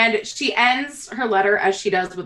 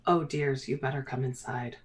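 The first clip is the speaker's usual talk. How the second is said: low, hushed invitation; hesitant; 7 semitones lower, 11 dB quieter than usual. Acted. Mayor hesitates, asks visitors inside, low and soft. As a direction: drop your voice and usher them in. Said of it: "kind of hesitates and goes".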